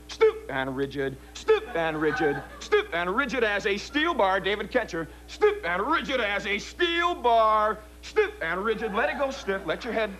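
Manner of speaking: slow, drawn-out stiff and rigid; hypnotic command. Acted. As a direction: stretch out each command. Repeated rigidity suggestion.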